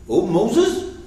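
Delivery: retold reproachful address; mock-angry God. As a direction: imitate an exasperated God, pitch rising. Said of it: "God got angry".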